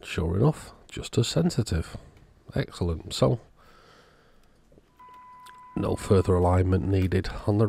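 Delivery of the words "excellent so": ordinary delivery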